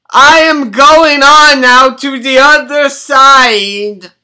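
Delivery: hammed-up bellowing orator sliding into gibberish names; mock grand confession. Grandson teasing grandma with lies, hammed-up bellowed confession. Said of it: "You sound terrible. What kind of talk is that?".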